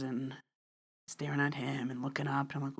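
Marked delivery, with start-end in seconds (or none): none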